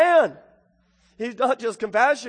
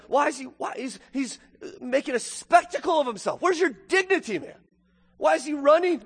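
higher-pitched incredulous questioning; scandalized onlooker; 6 semitones higher than usual. Acted. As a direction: act out a shocked bystander's disapproval. Imagined onlookers shocked at running father.

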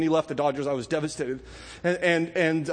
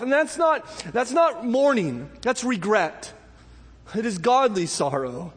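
fiery preaching; 5 semitones higher than usual. Acted. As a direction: preach it at full force to the congregation. Preaching at full force.

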